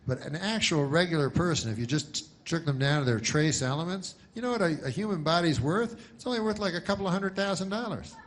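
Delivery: ordinary talk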